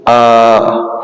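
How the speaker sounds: आ held long and open; long madd aa sound; drawn-out aa. Shown as a demonstration. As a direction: stretch the vowel in आ slowly. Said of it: "ये खींचते हुए पढ़ा जाएगा".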